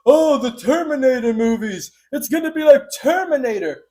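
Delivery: high, loud mimicry of panicky naysayers; mock alarmed public. Everyone objecting that AI will be Terminator, mocking outcry. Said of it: "And everyone's like".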